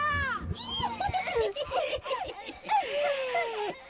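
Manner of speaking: long high wicked cackle; evil cackle. Acted. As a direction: cackle over her victim. Witch mocks girl's cries.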